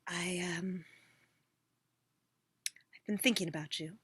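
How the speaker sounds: longing ex woodpecker murmuring to the tree; lovesick woodpecker. Acted. Woodpecker's lovelorn voice.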